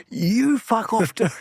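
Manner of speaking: high, slow mock snap; snapping retort. Speaker snaps at his wife holding the camera, mock angry. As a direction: tell her off, half-joking.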